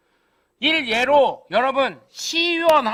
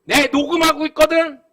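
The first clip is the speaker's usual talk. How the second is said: louder, fast angry threat; 5 semitones higher, 8 dB louder than usual. Retold or imitated. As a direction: threaten to expose him to the whole neighbourhood. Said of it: "내가 그래버렸어요". (녹음했다며 위협하는 큰 목소리)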